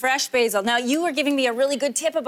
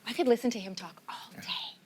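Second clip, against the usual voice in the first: whispering; 9 dB quieter than usual. Real, not acted. Admiring aside while chef talks.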